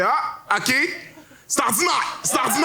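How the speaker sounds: high shrieking "c'est ordinaire"; mocking judge. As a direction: pitch it way up and squeal the dismissal. Judge mocking comedian job.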